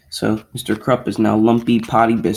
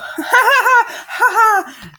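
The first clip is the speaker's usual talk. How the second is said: high, loud cackling ha-ha-ha; shrill kids' taunting laughter, hammed up; mocking laugh; 5 dB louder than usual. Retelling how kids taunt their victims, mocking high laugh.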